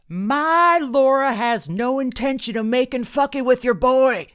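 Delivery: high, loud outraged yell; screamed. A narrator's reading. Dad points his steak fork at mom, screaming. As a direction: scream it across the restaurant. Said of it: "he screamed".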